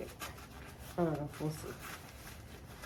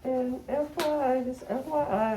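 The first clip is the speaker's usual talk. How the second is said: normal talking voice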